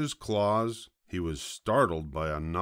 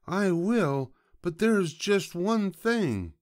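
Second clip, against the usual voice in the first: high-pitched, louder piglet voice; resigned piglet; 12 semitones higher, 4 dB louder than usual. A narrator's reading. Piglet bargaining with the fox, in a high voice. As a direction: give in, with a condition, up high. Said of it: "sighed the piglet".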